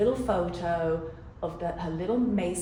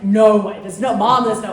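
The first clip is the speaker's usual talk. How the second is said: louder mock protest from a teenage son; 9 dB louder than usual. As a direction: push back loudly, half-hamming it. Son protesting in retold scene.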